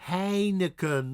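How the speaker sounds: usual speaking voice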